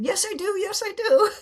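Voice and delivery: high, insistent "Yes, I do" said twice; indignant protest. Retold or imitated. Insisting to her inner teacher, high indignant protest.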